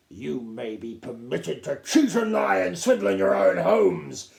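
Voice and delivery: high, loud scorn; contemptuous lecture. A narrator's reading. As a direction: lash out at them. Captain lectures.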